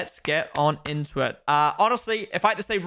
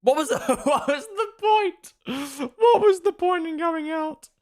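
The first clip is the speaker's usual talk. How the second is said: laughing in exasperation; 11 semitones higher, slower than usual. Real, not acted. Frustration at the stoppage.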